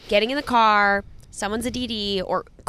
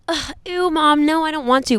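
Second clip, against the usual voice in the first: put-on higher, whiny bratty kid refusing; 7 semitones higher, 3 dB louder than usual. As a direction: whine at mom like a sulky child. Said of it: "instead of going".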